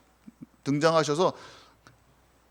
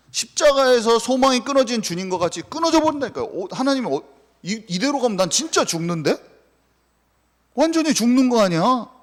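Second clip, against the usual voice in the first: desperate cry; 9 semitones higher than usual. Retold or imitated. Preacher voicing despair before God.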